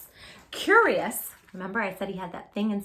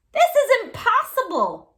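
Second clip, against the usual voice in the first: high, loud, slow frustrated cry; frustrated yell; 13 semitones higher, 10 dB louder than usual. Acted. Andres frustrated with the cube, yelling. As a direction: shout out in defeat. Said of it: "Andres yelled".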